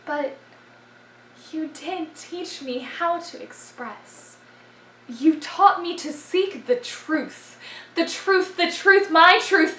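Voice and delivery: spoken-word confession that surges and recedes; poem recitation. Acted. Performer confessing love to forensics, surging poem recitation.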